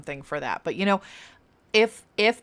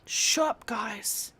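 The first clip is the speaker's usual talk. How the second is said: exasperated shut up; 6 dB quieter, much slower than usual. Retold or imitated. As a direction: toss it off, fed up with them. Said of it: "And I'm just like".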